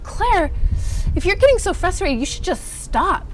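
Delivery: high, loud motherly nag, sing-song; imitating mom. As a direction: play mom telling her daughter to quit. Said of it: "she'd be like".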